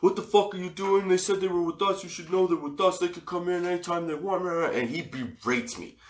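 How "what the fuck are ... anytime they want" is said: high-pitched mock tirade; hammed-up angry scolding, strained high; imitated yelling. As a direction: rant like the guy berating you, without full volume. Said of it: "He comes out screaming and yelling at me".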